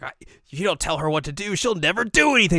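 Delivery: high, loud quarrelling voice; exasperated retort. Retold or imitated. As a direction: build to a loud mock complaint. Acted quarrel line.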